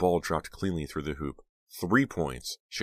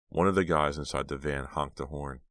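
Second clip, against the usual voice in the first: ordinary delivery, nothing marked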